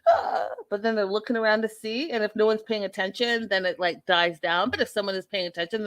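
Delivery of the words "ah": a high mock child's whine; mock tantrum wail. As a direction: wail like a toddler testing the room. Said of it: "they do".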